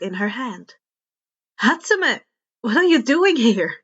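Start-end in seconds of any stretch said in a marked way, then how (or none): 1.6-3.7 surprised exclamation. Acted. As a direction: caught off guard, call her name. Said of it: "Izuku exclaimed without thinking".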